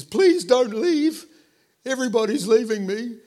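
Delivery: high, loud, hammed-up begging; mock pleading. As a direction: wail it like a needy, abandoned leader. Mock Jesus begging disciples to stay.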